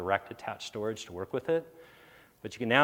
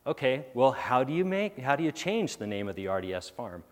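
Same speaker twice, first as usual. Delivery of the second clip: normal talking voice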